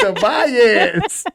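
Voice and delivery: laughing, name rising like a question. Real, not acted. Teasing a mother over her favorite son, laughing. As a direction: laugh out "Tobias" with the voice going up at the end.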